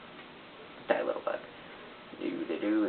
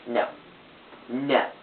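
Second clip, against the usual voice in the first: ordinary delivery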